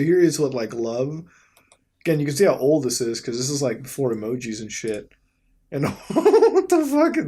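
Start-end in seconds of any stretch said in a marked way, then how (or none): 5.7-7.3 laughing. Real, not acted. Laughing at a silly photo.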